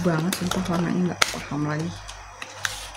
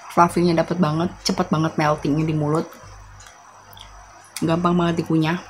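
chewing while talking; faster than usual. Real, not acted. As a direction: mouth partly full of marshmallow. Seen 0: bit marshmallow at -3, mouth working and licking lips while speaking.

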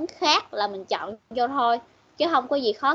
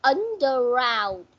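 Underground said slowly, "Un" pitched up then falling away; stress on first syllable; slower than usual. Shown as a demonstration. Teacher quizzing student on stress, slow Underground with stressed "Un". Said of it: "Ờ, chỗ chữ U".

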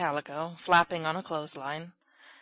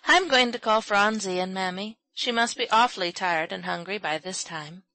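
plain reading voice

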